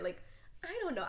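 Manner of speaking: normal talking voice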